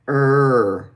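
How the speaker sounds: held er with tongue bunched back; back R demo. Shown as a demonstration. Teacher showing the lazy pirate R, held er. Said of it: "Er, right?".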